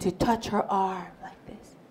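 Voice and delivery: hushed storyteller whisper. Real, not acted. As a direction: drop to a whisper for the scary part. Whispered tense story moment.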